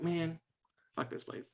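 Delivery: quiet, barely voiced grumble; disaffected muttering. Bully sulking at the carnival.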